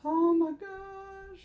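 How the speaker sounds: slow, high, drawn-out squeal; flustered squeal. Real, not acted. Nervous podcaster squealing to listeners, high and slow. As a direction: let the nerves squeak out. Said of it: "I get nervous".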